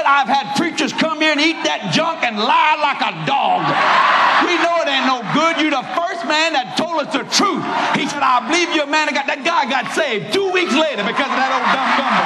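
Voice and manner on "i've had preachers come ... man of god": grateful husband. Retold or imitated. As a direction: declare it with conviction. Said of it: "That man said".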